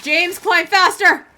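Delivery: loud and urgent, drawn out; shouting. Real, not acted. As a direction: yell at him to hurry. Urging character in game.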